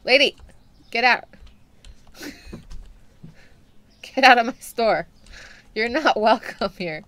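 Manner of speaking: loud, firm get out; yelling her out. Real, not acted. Shopkeeper to the creepy lady, loud and angry. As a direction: throw her out with a shout.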